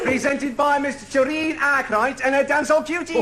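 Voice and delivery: showman announcer. Acted. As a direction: sell it like a saloon compère. Compère announces the cabaret.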